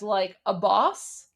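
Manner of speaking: "a boss" said slowly as the model for "Abbas"; slow sound-alike. Shown as a demonstration. Showing viewers the last part sounds like a boss, slow demo. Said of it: "pronounce it as".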